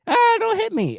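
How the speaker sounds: very high squeaky plea of a game extra; pleading victim. Retold or imitated. Podcaster mimics a game extra begging not to be shot, high squeal. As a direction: squeal it like a scared bystander.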